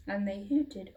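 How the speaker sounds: ordinary delivery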